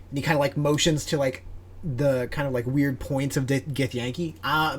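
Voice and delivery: ordinary delivery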